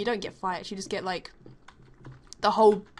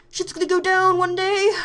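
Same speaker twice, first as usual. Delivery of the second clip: high, loud ominous prediction; pitched-up teen dread; mock dramatic warning; 10 semitones higher, 7 dB louder than usual. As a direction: announce the coming showdown with glee. Anticipating trouble in art class.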